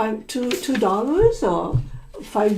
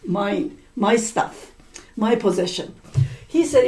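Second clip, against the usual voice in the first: ordinary delivery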